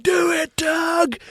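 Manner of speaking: high yelled cheer; imitating a shout. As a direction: call out like a sideline fan. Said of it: "he shouts at the contestants".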